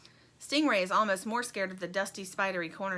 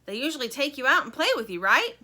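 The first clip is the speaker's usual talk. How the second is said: raised, louder, quick character chatter; higher, brisk boxed-in character voice; bugle voice; 5 semitones higher, 7 dB louder than usual. A narrator's reading. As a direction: rattle it on, pitched up and loud. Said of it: "the voice goes on".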